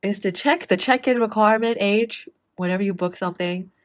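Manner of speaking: hammed-up wise moral; mock solemn moral. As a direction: deliver the moral like a preacher. Jokey moral of the story.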